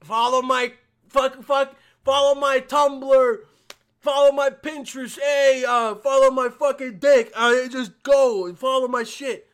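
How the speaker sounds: loud, high, slow hollering parody; mock a needy self-promoter at the top of your voice; hammed-up shouted plug; mocking influencer plug. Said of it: "popular pussies always be like".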